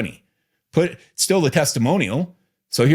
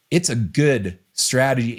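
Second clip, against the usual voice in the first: ordinary talk, nothing marked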